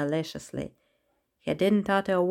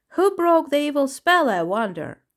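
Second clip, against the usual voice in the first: high, louder opening falling to the usual pitch; merry; 7 semitones higher, 4 dB louder than usual. A narrator's reading. Elephant freed from the spell wonders who broke it, merrily. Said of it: "Donut said merrily".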